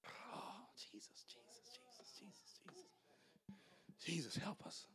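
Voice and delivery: breathy whispered calling on Jesus; preacher whispering to Jesus mid-sermon; whispered prayer. Real, not acted. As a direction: pray under your breath, almost silent. Preacher breaks into quiet prayer.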